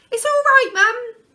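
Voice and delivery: put-on high, loud child's voice reassuring. A narrator's reading. Lester reassuring mum after the crash, high and loud. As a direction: reassure mum like an eager small boy. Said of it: "he reassured her".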